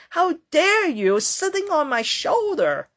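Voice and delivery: high-pitched, indignant telling-off; hammed-up affronted outburst; mock angry yelling. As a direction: act out scolding someone with exaggerated outrage. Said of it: "Don't yell at the tree leaves".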